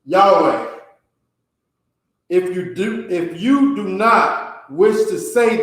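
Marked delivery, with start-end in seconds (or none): 0.0-0.7 "Yahweh" said as YAH-way, firm and a bit louder; original pronunciation. Shown as a demonstration. Teacher giving the class the original sound of the name, firm pronunciation. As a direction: give "Yahweh" as YAH-way, clearly, for everyone. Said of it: "approximation of the original sound of the name is".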